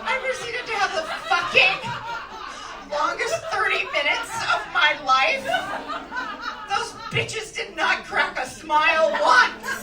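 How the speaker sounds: loud, high comic outrage; exasperated outburst. Retold or imitated. Comic telling the crowd about bombed thirty minutes, exasperated outburst.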